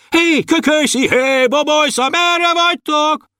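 very high searching calls; everyone shouting. A narrator's reading. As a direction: call high like a searching crowd. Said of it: "mind kiabáltak". (nagyon magas, keresgélő kiabálás)

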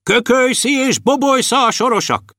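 high-pitched herald's announcement; calling out. A narrator's reading. Herald calling out names in story. (magas hangú, kikiáltó bejelentés)

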